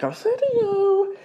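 high-pitched coaxing conscience; little shoulder angel. Acted. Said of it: "little angel on my shoulder was like".

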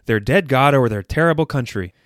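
normal talking voice

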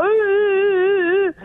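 long, high drawn-out ooh; mock "ooh" taunt. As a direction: hoot tauntingly, stretching it out. Taunts host over threatened kick.

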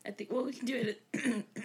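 hoarse, catching throat; frog in throat. Real, not acted. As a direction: talk through a frog in the throat. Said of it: "Frog in my throat".

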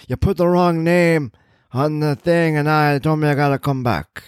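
put-on high, querulous old man voice; cranky old man.

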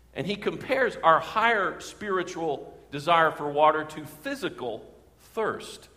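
usual speaking voice

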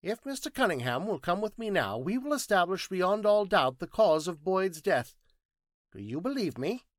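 high, emphatic scientist's voice; confident, high-pitched declaration; Thinking Machine, assured. Acted.

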